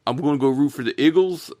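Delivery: badly faked Philadelphia accent; half-hearted mock Philly accent. Shown as a demonstration. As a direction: have a clumsy go at sounding Philly. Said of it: "I can't do a".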